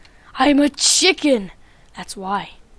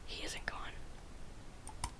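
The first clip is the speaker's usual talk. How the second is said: slow unvoiced whisper; 28 dB quieter, much slower than usual. Hiding chicken warns itself the hunter remains, slow whisper. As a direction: breathe it out in hiding.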